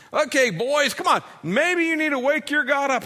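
high, loud taunting holler; mock taunting shout. Elijah taunting the prophets of Baal, loud mocking holler. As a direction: jeer at them across the mountain. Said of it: "And he's screaming".

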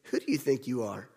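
low, soft, hushed repeat of the question; quiet reflection. Shown as a demonstration. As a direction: let the question sink in softly.

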